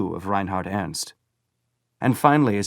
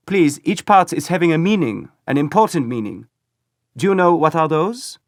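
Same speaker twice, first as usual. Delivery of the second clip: put-on high, loud eager German accent; keen boy lecturing a foreigner; 9 semitones higher, 8 dB louder than usual. A narrator's reading. First boy explains the flag's meaning, loud German accent.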